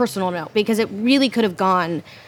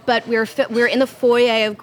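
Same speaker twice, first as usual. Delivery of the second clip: normal talking voice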